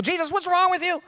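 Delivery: high-pitched indignant challenge; angry Peter. Acted. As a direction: scold in exasperation, pitched high. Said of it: "Peter was angry".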